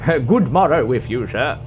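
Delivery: hammed-up courtly greeting; olde English gentleman.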